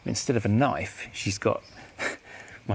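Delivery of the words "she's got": ordinary talk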